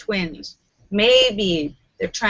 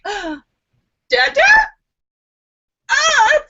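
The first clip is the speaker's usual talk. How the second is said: put-on very high baby cry rising louder; baby wail; 5 dB louder than usual. Baby lost between identical dads, high rising wail. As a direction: wail like a confused toddler. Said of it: "the baby's like".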